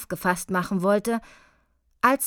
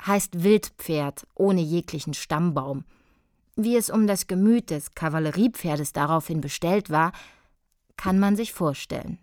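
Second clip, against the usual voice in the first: ordinary delivery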